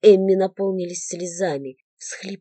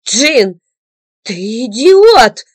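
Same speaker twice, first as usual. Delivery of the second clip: loud, slow angry cry; woman bursting in, shouting at him; 9 dB louder, much slower than usual. A narrator's reading. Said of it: "раздался крик".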